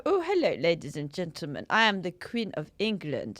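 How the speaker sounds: a hint of a French accent in a posh Queen impression; mock-posh Queen, drifting French; posh Queen voice, accidentally French. Doing the Queen for a friend, accidentally French. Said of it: "coming out French ... trying to be posh".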